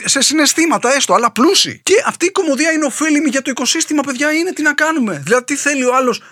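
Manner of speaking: high-pitched mock-outraged satirical monologue; ironic rant. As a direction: rant with deadpan irony, pitched well above normal.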